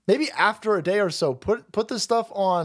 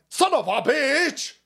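much higher, slow, put-on sneering voice; mock Davos villain; 10 semitones higher than usual. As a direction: ham up a cartoon elitist's rage. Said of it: "a bunch of lizard people at Davos, like".

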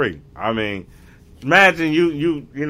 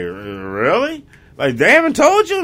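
shocked disbelief; 7 semitones higher, 4 dB louder than usual. Retold or imitated.

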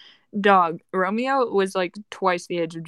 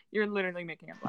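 softer, fast, under breath; quick quiet mutter; 2 semitones lower, 11 dB quieter, much faster than usual. Real, not acted. Repeated accusation.

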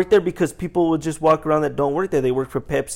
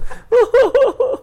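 high, loud mock reaction laugh; imitated customer reaction; 6 dB louder than usual. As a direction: imitate customers getting the joke. Imitates customers catching the joke.

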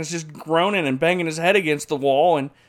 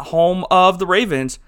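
ordinary talk, nothing marked

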